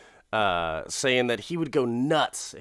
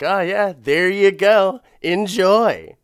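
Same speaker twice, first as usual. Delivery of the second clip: high, loud and slow mock sweetness; imitating the wife's sarcastic offer, much louder; 8 semitones higher, 10 dB louder, slower than usual. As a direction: serve it up with fake cheer. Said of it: "she's like".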